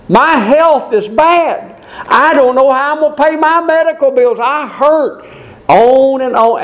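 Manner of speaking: complaining listener. Acted. Objector lists his troubles.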